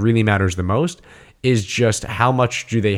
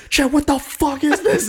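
a much higher, frantic squeal of disbelief; upset panic. Retold or imitated. Demanding answers from roommate Chet about the ending, squeaky panic. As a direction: ham up a freaked-out demand. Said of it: "I was upset".

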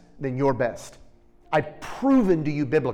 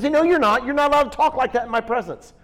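higher, louder, firm denial; firm rebuke; 10 semitones higher, 6 dB louder than usual. Acted. Jesus forbidding the listener to insult themselves, firm and loud. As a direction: shut the insult down hard.

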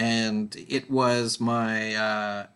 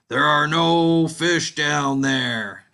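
slow, loud and a bit higher from below; the punchline voice, louder and slower; mysterious voice; 4 semitones higher, 7 dB louder, much slower than usual. Acted. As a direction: draw it out like a booming unseen voice. Joke voice from the ice hole.

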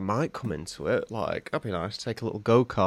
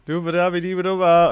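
higher, louder gibberish faking German; mock German gibberish; 7 semitones higher, 6 dB louder than usual. Teasing a friend who fakes fluent German back. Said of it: "You're like".

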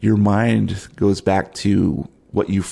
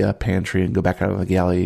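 usual speaking voice

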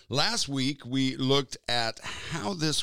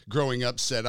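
ordinary talk

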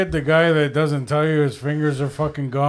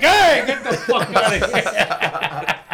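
slow, laughing brush-off; laughing mock complaint; 4 semitones higher, slower than usual. Workers shooing the man off their shoulders, laughing. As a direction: shoo him off, cracking up. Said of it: "So they'd be like".

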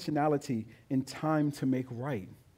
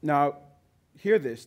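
ordinary talk

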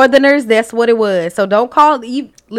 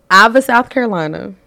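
ordinary delivery

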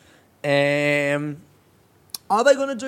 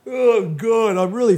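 through a big yawn; 6 semitones higher, 6 dB louder than usual. Real, not acted. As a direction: let the yawn swallow it. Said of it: "fucking the trumpet today with these yawns".

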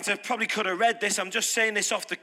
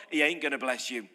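much lower, flat warning; grave low-pitched warning; low firm verdict; 8 semitones lower, 4 dB quieter than usual. Real, not acted. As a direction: lay the verdict down heavily.

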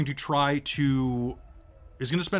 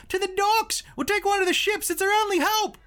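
put-on high-pitched twelve-year-old calling out; scrappy youngster. Kid leading fleeing civilians to the ships, high scrappy youngster voice. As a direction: cry it out as a plucky kid rallying a crowd. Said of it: "a scrappy youngster of about twelve".

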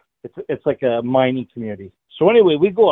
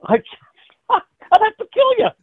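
high laughing joke; laughing tease; 13 semitones higher, 3 dB louder than usual. Real, not acted. Joking to friends that he'd have to kill them, laughing. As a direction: giggle through a mock threat.

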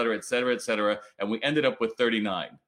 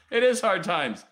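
higher and louder, punching "is" in "It is hard times"; defiant outburst; 8 semitones higher, 4 dB louder than usual. Real, not acted. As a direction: hit back hard on "is". Answers the comment defiantly.

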